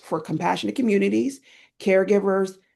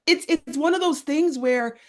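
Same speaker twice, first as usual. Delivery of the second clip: ordinary talk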